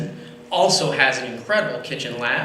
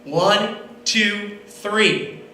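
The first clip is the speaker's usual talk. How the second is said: count-in; 5 semitones higher, much slower than usual. Shown as a demonstration. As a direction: count them in so they all start together. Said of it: "Can we say it together on three?".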